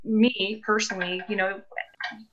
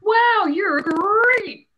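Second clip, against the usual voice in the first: loud, high, drawn-out cheer; cartoon tiger catchphrase; 10 semitones higher, 5 dB louder, much slower than usual. Host hailing viewer Tony Tiger, big cartoon catchphrase. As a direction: roar it out slowly and big.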